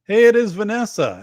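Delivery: light up on the name; cheerful loud hello to a fan; excited greeting. Real, not acted. Greeting a chat viewer.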